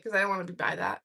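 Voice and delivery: ordinary delivery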